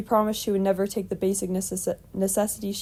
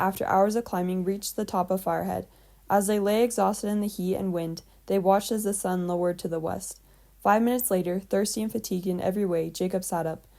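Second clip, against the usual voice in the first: usual speaking voice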